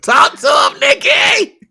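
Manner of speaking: high, screamed hype; mock fan cheering. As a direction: scream it like a hammed-up superfan. Mock fan hyping up [name].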